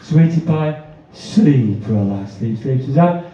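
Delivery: slow, soothing, sinking commands; hypnotist induction. Pretend. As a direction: pull the volunteer down into deep sleep.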